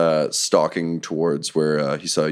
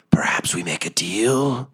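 slow whispered creature voice in the head; a sly monster whispering into a mind; telepathic whisper; 2 semitones higher than usual. Acted. Nothic whispering into the raging barbarian's brain, slow whisper. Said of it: "You can hear in, in your, through your rage in your brain".

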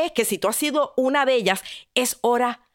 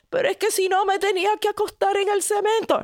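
whiny maiden; 9 semitones higher, faster than usual. Acted. Maiden excuses herself.

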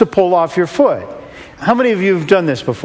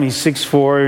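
plain reading voice

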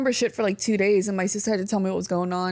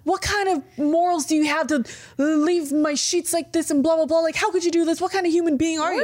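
indignant rant, pitched higher; angry; 5 semitones higher than usual. Retold or imitated. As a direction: scold like an outraged host, mockingly. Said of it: "messaged me angry".

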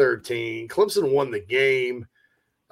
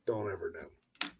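whisper; 8 semitones lower, 15 dB quieter than usual. Real, not acted. Trailing remark.

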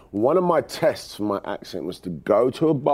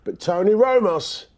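higher, slow dramatic promo read; sports promo voice; 9 semitones higher, 3 dB louder, slower than usual. Shown as a demonstration. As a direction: boom it out like a hype trailer. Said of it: "can I just do it in my own accent".

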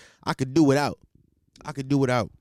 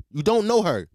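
usual speaking voice

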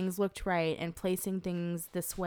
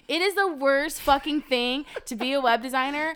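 rising frustrated rant, higher and louder; venting about her own website, louder and higher; getting mad; 6 semitones higher, 5 dB louder than usual. Real, not acted. Web designer ranting about her own website, getting mad. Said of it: "She's getting so mad".

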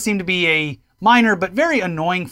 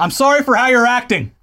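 overly polite, higher and louder; polite Canadian; 6 semitones higher, 4 dB louder than usual. Retold or imitated. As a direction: scold them in a sweet polite Canadian way. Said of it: "Very polite".

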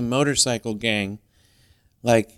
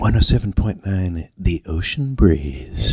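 low, slow late-night radio voice; smooth radio DJ; 7 semitones lower, slower than usual. Pretend. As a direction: purr the station call like a DJ. Radio DJ station ID.

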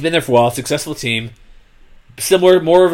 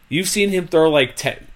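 normal talking voice

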